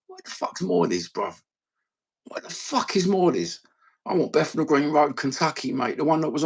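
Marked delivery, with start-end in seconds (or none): 0.1-3.6 high-pitched, incredulous question; playfully indignant; mock outrage. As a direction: act baffled, pitch jumping then falling. Baffled by missus's chicken shop.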